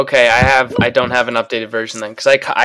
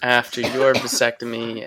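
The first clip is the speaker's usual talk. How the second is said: ordinary delivery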